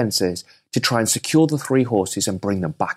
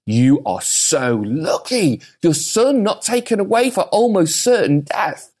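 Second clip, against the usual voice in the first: slow start then high, amazed villagers; mock-awed village gossip; village folk marvel; 6 semitones higher, slower than usual. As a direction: gush over his luck. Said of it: "Village folk go".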